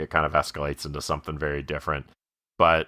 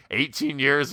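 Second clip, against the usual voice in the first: pitched-up proud answer; Pat proudly; 7 semitones higher, slower than usual. Retold or imitated. Host imitates Pat answering the dad, proud. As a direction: answer proudly, lightly voiced. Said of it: "when Pat's like".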